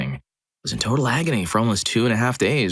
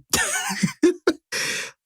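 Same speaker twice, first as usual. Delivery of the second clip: retold laugh. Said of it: "he laughed so hard".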